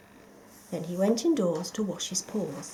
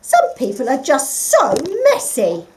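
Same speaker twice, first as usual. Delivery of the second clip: indignant; 5 semitones higher, 9 dB louder than usual. A narrator's reading. Partridge upset.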